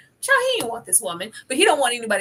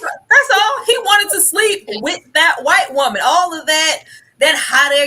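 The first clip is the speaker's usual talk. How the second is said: loud, high, exasperated; emphatic outburst; 7 semitones higher, 9 dB louder than usual. Real, not acted.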